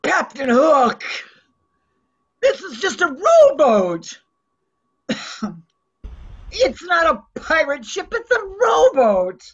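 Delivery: indignant tourist. Acted. Improv tourist complaining to pirate.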